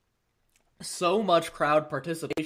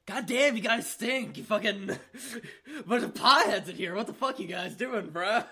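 higher, hyped-up rapper calling out the crowd; mock rapper taunt; 5 semitones higher than usual. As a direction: tease the stoned crowd like a cocky rapper. Rapper roasting the stoned crowd.

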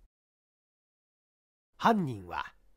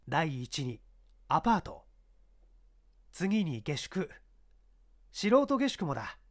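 higher, firm police chief giving orders; commanding inspector; 5 semitones higher, 3 dB louder than usual. Acted. Inspector instructs his subordinate, firm and raised. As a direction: order the men about with authority.

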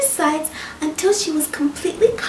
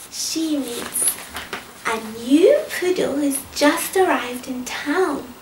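ordinary delivery

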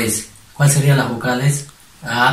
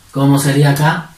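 normal talking voice

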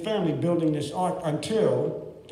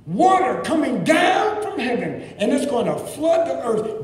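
excited exclaim; 8 semitones higher, 5 dB louder than usual. Acted. Preacher exclaiming.

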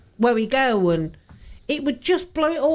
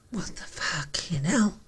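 whisper; 8 semitones lower, 9 dB quieter than usual. Real, not acted. Stunned after the footage.

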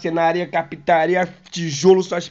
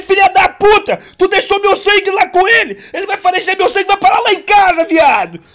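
much higher and louder, panicked outburst; panicked yell; 10 dB louder than usual. Retold or imitated. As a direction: shout in panic about the blood. Panicking that blood was left.

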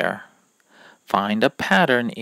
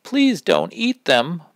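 mother's cry; 7 semitones higher, 7 dB louder than usual. Acted. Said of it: "Mother cried".

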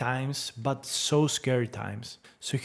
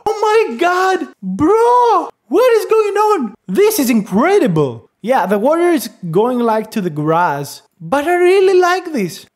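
much higher and louder, almost shrieking; screaming excitement; 13 semitones higher, 10 dB louder than usual. Real, not acted. Vlogger shrieks with amazement at the generated image. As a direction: lose it over the result.

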